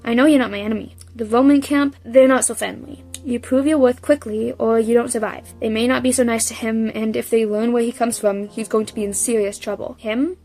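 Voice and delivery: steady read-aloud narration of the novel; reading the book aloud, voicing the dialogue lightly; story narration. A narrator's reading. Reading the Percy Jackson book aloud, calm narration.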